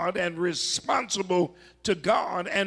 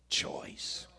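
dramatic whisper; 10 dB quieter than usual. Acted. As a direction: breathe the word out in a whisper. Preacher drops to hushed key word.

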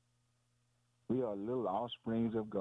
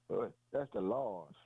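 normal talking voice

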